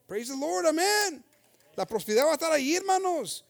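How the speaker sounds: loud, high preaching cry; fast, loud, rising call to the congregation; preaching at full force. Acted. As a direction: lift the church with a shout of praise. Preaching climax.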